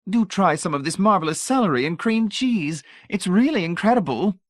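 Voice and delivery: put-on high, honeyed old lady voice; sugary old lady. A narrator's reading. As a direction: pour on the sweetness like a grande dame. Voices Mrs Pritchett.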